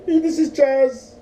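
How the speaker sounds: high, loud, drawn-out phone scammer parody; crazy-guy scammer caricature. Retold or imitated. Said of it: "I'm doing that, and that's bad 'cause it makes it look like he's".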